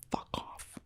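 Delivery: dismissive whisper. Real, not acted. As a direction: let it drop to a muttered brush-off. Dismissive end of a vent.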